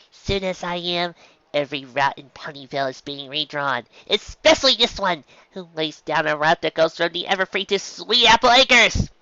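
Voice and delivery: irritated mail mare muttering aloud; grumbling mare voice. A narrator's reading. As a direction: grouse about the silly route. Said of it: "Dizzy grumbled".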